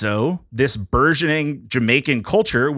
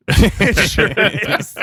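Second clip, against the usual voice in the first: laughing; 7 semitones higher, 4 dB louder, slower than usual. Real, not acted. Laughing at Dragon Ball joke.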